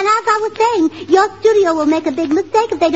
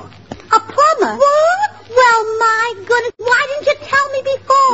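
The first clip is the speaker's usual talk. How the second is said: high, astonished outburst, first word stretched; shocked disbelief; 7 semitones higher, slower than usual. Acted. Wife learns her guest is a plumber, shocked. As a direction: react aghast to startling news.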